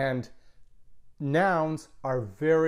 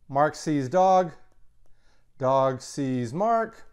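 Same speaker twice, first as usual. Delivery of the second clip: slow model sentences; much slower than usual. Shown as a demonstration. Teacher slowly models sentences.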